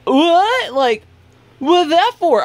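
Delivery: high-pitched imitation of an offended drunk woman; mock drunk indignation. Drunk woman answers being flipped off, high mock-indignant voice. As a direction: protest in a high mocking voice.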